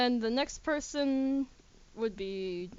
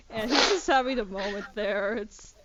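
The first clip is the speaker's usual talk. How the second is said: ordinary talk, nothing marked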